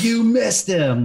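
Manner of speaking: high, loud, drawn-out mock taunt; singled-out failure, crowed slowly and high; mock gotcha. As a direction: taunt them in a put-on high voice. Imagined mocking instructor's gotcha.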